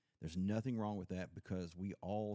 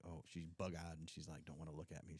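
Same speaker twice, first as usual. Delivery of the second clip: much softer, lower retelling; hushed aside; 3 semitones lower, 8 dB quieter than usual. Retold or imitated. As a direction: drop your voice, imitating her avoidance. Voicing a shocked interviewer, aside.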